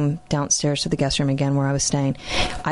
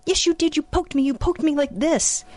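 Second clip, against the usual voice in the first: insistent, much higher-pitched mock accusation; imitating angry child; 12 semitones higher than usual. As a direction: argue back as her upset little son, high-voiced. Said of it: "he gets really angry".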